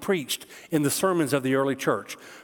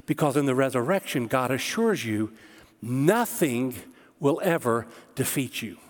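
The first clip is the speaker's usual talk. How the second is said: normal talking voice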